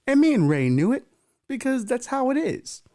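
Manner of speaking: normal talking voice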